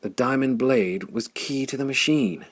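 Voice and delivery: plain reading voice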